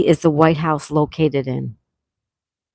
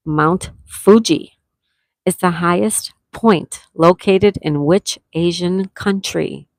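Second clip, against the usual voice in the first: slow; slower than usual. Shown as a demonstration.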